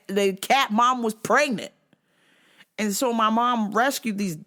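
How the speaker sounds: usual speaking voice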